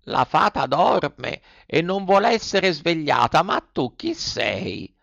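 the unhurried snail takes her time; slow snail voice. A narrator's reading. Snail voice in story. (lumaca lenta e flemmatica)

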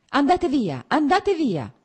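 high, loud cry, repeated with rising force; pained shout. A narrator's reading. Wife ordering husband away, shouting in pain. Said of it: "gridò senza guardarlo".

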